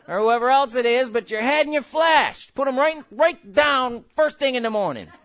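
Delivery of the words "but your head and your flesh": ordinary delivery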